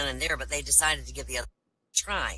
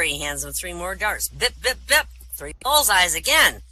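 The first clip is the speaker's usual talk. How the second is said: ordinary talk, nothing marked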